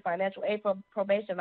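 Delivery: usual speaking voice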